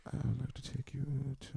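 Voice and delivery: barely audible, shy mumbling; shy guy too soft to be heard; timid mumble. Retold or imitated. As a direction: mumble it so she can't hear. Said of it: "they go up to the woman and go".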